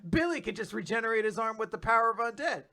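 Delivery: high-pitched, hammed-up battle commentary; sideline anime commentator. Bystander explains Billy's move mid-battle, hammed-up commentator. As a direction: exclaim it like a Pokemon sidekick narrating the fight. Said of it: "Misty or Brock or someone would stand aside and be like".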